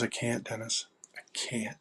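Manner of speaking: soft imitation of someone tearful; hushed, understated plea that fades out; retold tearful refusal. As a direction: quote a crying friend gently. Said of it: "she just started crying".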